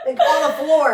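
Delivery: laughing. Real, not acted. Laughing over the bathroom story.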